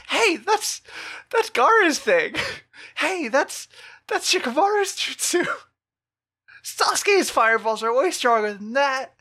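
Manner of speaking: high, loud nagging bystander; high mocking character voice. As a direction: squeal it like a know-it-all ninja. Voicing nearby characters.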